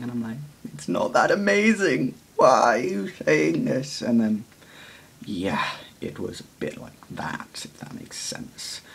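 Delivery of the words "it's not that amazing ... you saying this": mock flustered squeak. Embarrassed reply to a compliment.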